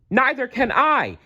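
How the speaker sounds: very high panicked bird cry; howl. Acted. Flitter flying blind, very high howling cry. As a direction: wail in fear. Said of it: "howled Flitter".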